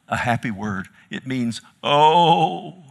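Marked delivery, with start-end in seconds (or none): 1.8-2.8 wounded cry. Retold or imitated. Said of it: "he's wounded in his heart".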